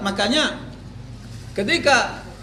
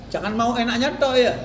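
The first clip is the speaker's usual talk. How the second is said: ordinary talk, nothing marked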